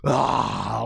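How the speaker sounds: hammed-up panting for air; mock heaving breaths; imitating exhausted gasps. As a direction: gasp like a spent fighter. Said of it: "Vegeta is exhausted right now. He's like".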